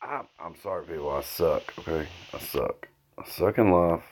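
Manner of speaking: softly muttered apology; quiet sheepish mutter. Real, not acted. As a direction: mumble it sheepishly. Self-deprecating aside.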